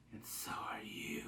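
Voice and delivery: put-on a captive girl menacing her captors; whispered threat. Ellie bluffing captors.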